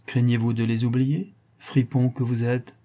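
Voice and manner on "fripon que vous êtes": usual speaking voice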